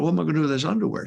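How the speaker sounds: rushed mock dismay. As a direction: blurt it out in a panic. Retold dismay.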